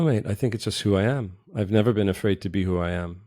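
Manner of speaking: usual speaking voice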